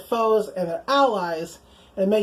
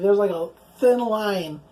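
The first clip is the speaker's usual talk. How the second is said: ordinary delivery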